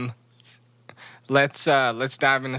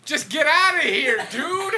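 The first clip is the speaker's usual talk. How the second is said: high, playful get-out-of-here; mock exasperated; 12 semitones higher, slower than usual. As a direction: shoo him off in fake disgust. Mock outrage at friend.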